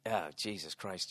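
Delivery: low, soft, slow sigh of exasperation; weary muttering. Real, not acted. Host recalling the heckling kid, weary mutter. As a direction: let it out slowly under the breath. Weary aside after the rant.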